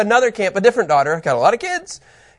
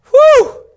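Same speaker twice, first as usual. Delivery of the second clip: loud, high "whew"; real burst of excitement; exhilarated whoop; 10 dB louder than usual. Real, not acted. Exhilarated, reports goosebumps.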